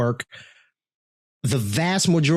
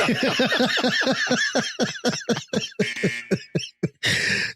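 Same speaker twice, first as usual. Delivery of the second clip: long high laugh; 9 semitones higher than usual. Real, not acted. Laughs at own joke.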